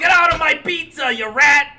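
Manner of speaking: loud, high mock Italian shout; comic Italian accent, shouted; fake Italian accent. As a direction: yell it in a hammed-up Italian accent. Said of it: "Give me your Italian".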